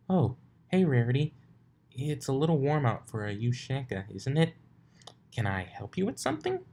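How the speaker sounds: high, bright Twilight voice; Twilight greeting. A narrator's reading. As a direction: greet her cheerfully, a bit puzzled.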